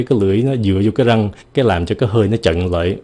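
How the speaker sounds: ordinary talk, nothing marked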